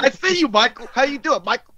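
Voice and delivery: high playful singsong greeting; high goofy voice. Retold or imitated. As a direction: squeak it like a giddy friend.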